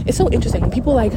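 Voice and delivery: usual speaking voice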